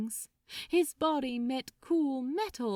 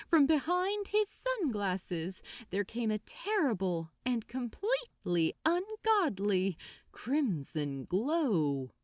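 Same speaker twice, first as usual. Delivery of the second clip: ordinary delivery, nothing marked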